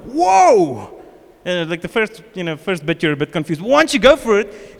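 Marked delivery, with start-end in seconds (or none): 0.0-1.0 high, loud, drawn-out awe; amazed cry. Retold or imitated. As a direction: exclaim like someone blown away. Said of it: "people that pray in tongues go like".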